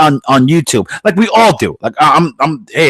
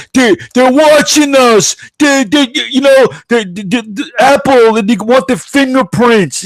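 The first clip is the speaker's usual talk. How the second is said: mock paranoid panic; 11 semitones higher, slower than usual. Host mocking people scared of being watched, mock panic. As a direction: imitate a conspiracy worrier stumbling over panicked words. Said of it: "why people talked about".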